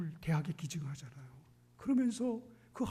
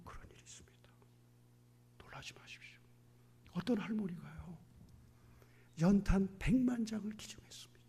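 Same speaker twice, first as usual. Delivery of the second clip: hushed reveal; 5 dB quieter than usual. Real, not acted. Secret-like whispered announcement.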